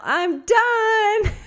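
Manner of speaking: high, loud holler with the word drawn out; yell. Acted. Injured sister on the toilet calling her sibling, long high yell. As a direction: call out through a door to someone downstairs. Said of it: "crack the door and yell".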